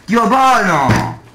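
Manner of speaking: slow furious shouting, high and loud; explosive italian cursing. Real, not acted. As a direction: spit out each word in rage. Dies to lag, raging.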